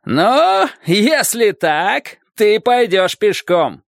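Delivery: very slow, high menacing call; high storybook shout from the island; shouted threat. A narrator's reading. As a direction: stretch each word as a warning. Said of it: "крикнул человек на острове".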